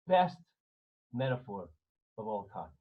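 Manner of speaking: ordinary delivery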